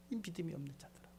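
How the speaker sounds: quick hushed echo of the scolding; hushed repeat. Retold or imitated. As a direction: drop to a near murmur of sad rebuke.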